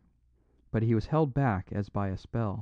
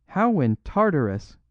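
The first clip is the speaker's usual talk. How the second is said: loud startled outcry; outlaw crying out; 7 semitones higher, 6 dB louder than usual. A narrator's reading. Flambeau the outlaw cries out in disbelief at the priest, loud. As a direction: cry it out in disbelief. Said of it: "cried Flambeau".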